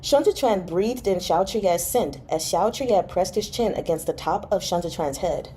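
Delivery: ordinary delivery, nothing marked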